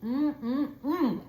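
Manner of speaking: high, loud mm-mm of pleasure while chewing; savoring mm. Real, not acted. Cook tasting her own fish for viewers, pleased humming. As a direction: hum with delight at the taste. First bite of her dish.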